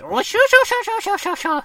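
shrill high tittering laugh; silly character laugh. Retold or imitated. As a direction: cackle it very high and loud, fading out. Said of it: "is his laugh".

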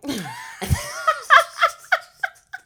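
loud high-pitched laughter. Real, not acted. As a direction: burst out laughing loudly. Big laugh.

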